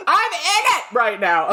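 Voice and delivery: loud, high, drawn-out comic cry; laughing outburst. Real, not acted. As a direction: belt it out, half laughing. Joking self-aware outburst while laughing.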